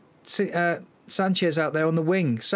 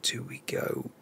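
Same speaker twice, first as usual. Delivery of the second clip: breathy private whisper to himself; whispered self-question before a risky switch; 9 dB quieter than usual. Real, not acted. Muttering a tactical decision.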